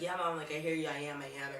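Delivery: ordinary talk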